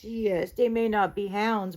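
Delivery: plain reading voice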